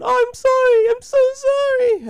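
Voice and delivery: very high, drawn-out wailing apology; mock bawling. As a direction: imitate a girl sobbing her apology. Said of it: "she's bawling".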